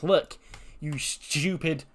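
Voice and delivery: slow, drawn-out diva sneer; a lightly voiced snooty heel; mock Tiffany promo. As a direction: talk down to them slowly, like a haughty champ. Recap acting out Tiffany's promo.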